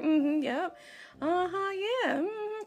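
high, singsong mock agreement; humoring along. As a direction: nod along to a child you can't follow, pitched high. Humoring chattering daughter without understanding.

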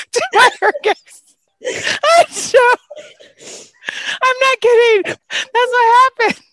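high and loud, still laughing; laughing insistence. Real, not acted. Insisting to friends the story is true, laughing. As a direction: insist through the giggles.

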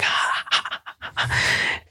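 breathless voiceless dog huffs, ha ha; happy dog panting. Retold or imitated. Imitating the happy setter bounding onstage, soft panting. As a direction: pant like an overjoyed dog. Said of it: "He's like".

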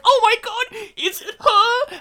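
high, loud, drawn-out hammed-up shriek; mock frightened cry. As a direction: play the terrified heroine to the hilt. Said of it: "And Rosario Dawson's like".

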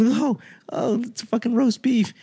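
high-pitched whiny-critic imitation; high mock objection; mocking complainers. Mimicking complaint about roast beef.

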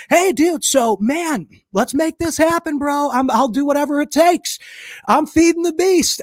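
high, hyped-up hustler plea; eager pitch. Retold or imitated. Fan pitching himself to Cardone, high and eager. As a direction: sell it with overexcited energy.

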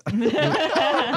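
high choked eagle cry; eagle screech. Pretend. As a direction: squawk like a choking eagle. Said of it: "It's an eagle choke".

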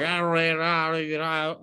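fast slurred Southern trucker talk, words run together; mumbled Southern drawl. Retold or imitated. Southern trucker on the phone, slurred and rushed. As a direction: mush the words like a Boomhauer-style trucker. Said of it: "he's like".

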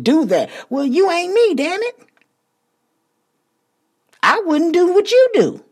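high, loud, defiant preaching; fired-up shout. Real, not acted. Podcast host rallying listeners, loud and defiant. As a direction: shout it down at every doubter. Urging listeners, defying naysayers.

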